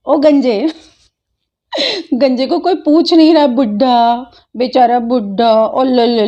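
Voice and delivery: laughing, sing-song jeer at a bald man; mocking taunt. Real, not acted. Mocking a bald critic in a teasing voice.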